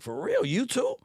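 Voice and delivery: a genuinely amazed "you too?"; surprised disbelief. Retold or imitated. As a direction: pitch shoots up on for real. Reacting to Larry's confession.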